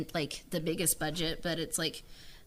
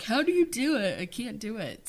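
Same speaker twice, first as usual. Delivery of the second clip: mock helpless; 4 semitones higher than usual. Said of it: "I'm like".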